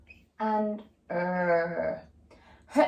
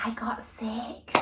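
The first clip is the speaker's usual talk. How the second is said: whisper; 3 semitones higher, 9 dB quieter, slower than usual. Acted. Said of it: "pupil voice".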